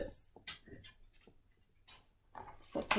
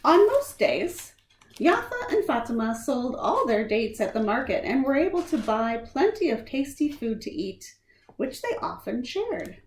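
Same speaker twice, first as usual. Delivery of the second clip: lightly lilting read-aloud voice; storybook reading; 2 semitones higher, 4 dB louder than usual. Acted. Reading a picture book to children, gently lilting. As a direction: tell it gently to young listeners.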